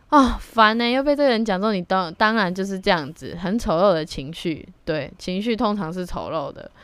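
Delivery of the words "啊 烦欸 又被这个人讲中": annoyed. Retold or imitated. Annoyed at being right.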